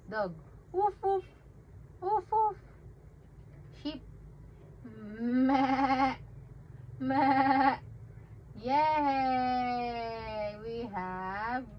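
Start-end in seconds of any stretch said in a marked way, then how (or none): 0.7-2.6 high, loud playful woof; high silly dog noise; dog barking. Pretend. Animal sounds for baby. 5.2-7.8 loud playful bleating meh; loud silly sheep noise. Pretend. Animal sounds for baby.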